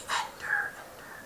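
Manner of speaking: whispered fading echo. A narrator's reading. Narrator echoes the Ender name in a whisper. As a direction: let the word echo away softly. Echoing word.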